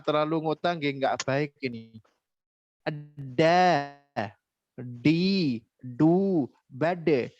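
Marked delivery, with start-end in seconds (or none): 3.1-7.3 low, firmly pressed DA, DI, DU, BAD; deliberate stopped d on every syllable. Shown as a demonstration. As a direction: lean on each d before releasing it. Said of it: "Harusnya ditekan".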